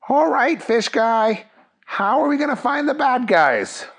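high cartoon cop voice; Jerry. Jerry asks the fish hero for leads, high-voiced.

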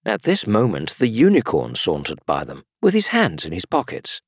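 usual speaking voice

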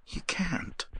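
high, soft and breathless; despairing whisper. A narrator's reading. Avery giving up on the professor, whispered. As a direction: breathe it out in defeat.

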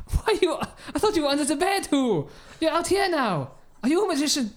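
very high-pitched comic bewilderment; baffled astonishment. Acted. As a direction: squawk your questions in mock amazement. Finds suspect no longer under bed.

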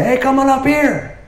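high mocking call from up the hill; taunting soldiers. Philistines daring Jonathan to climb, high taunt. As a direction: sing it out like a cocky soldier daring them.

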